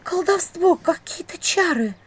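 high, slow, frightened stage whisper. A narrator's reading. Vasilisa struggling with the stuck ring, frightened whispering. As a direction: draw it out high and scared. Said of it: "испуганно шептала она".

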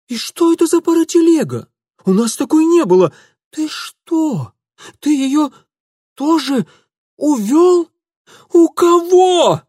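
high, loud voice breaking in horror; horrified aunt. Acted. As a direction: voice cracking with terror. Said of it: "Голос тётушки сорвался от ужаса".

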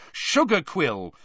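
high, drawn-out shout; angry yell. A narrator's reading. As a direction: fire off another frustrated guess. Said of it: "he yelled angrily".

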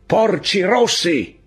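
slow, high, loud barked taunt; barked insult. Acted. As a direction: bark the slur out long and loud. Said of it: "gli insulti abbaiati dalla voce".

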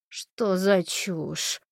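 очень медленно, со стоном; простонать досадливо. A narrator's reading. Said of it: "простонала редактор расследований". (медленный страдальческий стон)

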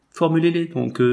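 impatient, narrated as peevish; testy. A narrator's reading. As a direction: say it with a touch of ill humour. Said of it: "dit monsieur Paulet avec humeur".

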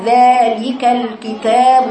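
slow "ذلك الكتاب" with each madd held; full elongation. Shown as a demonstration. Teacher demonstrating proper recitation, long vowels held. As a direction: draw out both long ā sounds in "ذلك الكتاب". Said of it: "مفيش مد".

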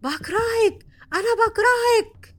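high, loud cries building each time; yelling hatred. Retold or imitated. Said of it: "وعمالة تزعق". (زعيق متصاعد بصوت حاد)

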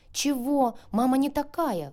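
loud, high indignant outcry; shouting. A narrator's reading. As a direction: yell it back in defence of mom. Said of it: "выкрикнула я".

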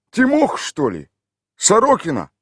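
high, put-on village voice; gruff local man answering a stranger; rough villager. A narrator's reading. Tolik answers the city stranger in a rough village voice.

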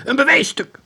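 very high, loud flourish; triumphant. A narrator's reading. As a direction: present the prize triumphantly. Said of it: "hielden triomfantelijk". (triomfantelijk, hoog en luid)

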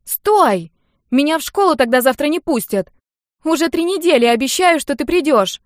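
high, loud pleading shout; urgent, indignant girl's yell; yelling after him. A narrator's reading. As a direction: yell in protest as he leaves. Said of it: "заорала Нелли, убегая вслед".

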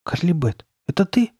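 put-on high-pitched boy's voice; Chuck asking. A narrator's reading. Boy checking who is behind the mask, questioning. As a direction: ask it high and uncertain. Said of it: "снова спросил Чак".

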